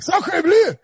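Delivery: high, hammed-up French "sacre bleu"; mock French accent. Host showing his joke French impression, high and mocking. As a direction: toss off a cartoon French exclamation way up high. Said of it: "I do a good French person speaking English".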